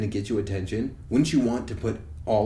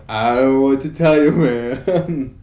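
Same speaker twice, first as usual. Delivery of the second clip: mock exasperated; 4 semitones higher, 10 dB louder, slower than usual. His reply to complaining fans.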